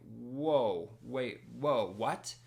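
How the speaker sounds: stunned, halting little exclamations; retold shock. Accused man hearing he may be charged, shocked. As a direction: replay being taken aback, word by word. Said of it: "I was shocked".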